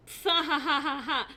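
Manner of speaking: stiff robotic reading of a laugh; text-to-speech voice. Shown as a demonstration. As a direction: read it like a speech-to-text bot. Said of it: "I read that like a fucking, auto text".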